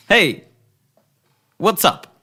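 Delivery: loud, high, friendly hail; cheery shout. Acted. Cop greeting the hostage taker, loud and high. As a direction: call up to him like an old pal. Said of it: "You're like".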